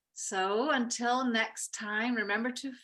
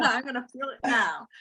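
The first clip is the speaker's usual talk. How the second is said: laughing through the line, louder; 7 dB louder than usual. Real, not acted. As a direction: giggle it out as you admit it. Laughing at her own delay.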